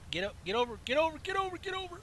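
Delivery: pleading, rising pitch; urging. Real, not acted. Urging car to change lanes.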